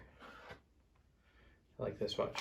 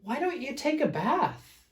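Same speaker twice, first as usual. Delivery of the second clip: much higher gentle bear voice; Big Fluffy Bear asking; 12 semitones higher than usual. Acted. Big Fluffy Bear suggests Smelly Bear bathe, high voice. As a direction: ask it up high like a sweet bear. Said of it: "asked Big Fluffy Bear".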